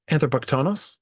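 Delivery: ordinary talk, nothing marked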